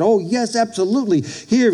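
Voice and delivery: eager agreement. Retold or imitated.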